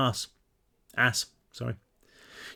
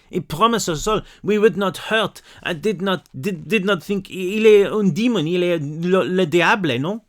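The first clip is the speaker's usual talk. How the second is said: put-on high, distressed French-accented girl; frightened French child, pitched high; broken English, French accent; 6 semitones higher than usual. A narrator's reading. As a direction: stumble through French-accented English, pleading. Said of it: "she said in broken English".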